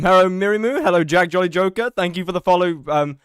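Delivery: ordinary talk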